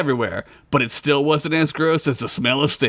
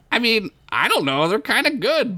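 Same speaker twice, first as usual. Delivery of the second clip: high character voice; 8 semitones higher than usual. Acted. Bilbert defends fries.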